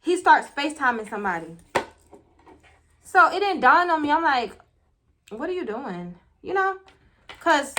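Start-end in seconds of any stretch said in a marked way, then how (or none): 5.3-6.1 softer, tentative asking; mild puzzled question. Retold or imitated. Woman noticing a call being made, soft puzzled question. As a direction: ask it quietly, not yet alarmed.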